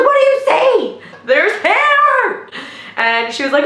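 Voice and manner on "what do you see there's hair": high, loud mock shriek; excited mother. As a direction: exclaim it high and loud as the thrilled mom. Acts out excited mother during labor.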